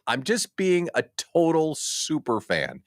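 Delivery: ordinary talk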